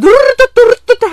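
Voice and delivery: loud high mock drumming of feet; imitated rumble. Imitating running feet noise. (høy, etterlignet tromming av føtter)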